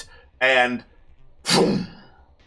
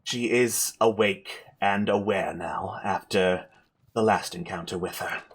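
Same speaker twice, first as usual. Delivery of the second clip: weary spellcaster; 6 semitones lower, 5 dB quieter than usual. Acted. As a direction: explain gravely with little strength left. Game master plays drained Amber.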